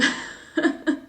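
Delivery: high little giggle; embarrassed laugh. Real, not acted. Author doesn't know what that means, embarrassed giggle. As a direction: laugh it off after admitting confusion. Laughs at not knowing the slang.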